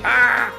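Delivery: high, hammed-up panic scream; short pretend shriek of alarm; mock scream. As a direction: cap the fake emergency with a yell. Mock emergency ends in a scream.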